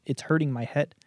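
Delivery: ordinary talk, nothing marked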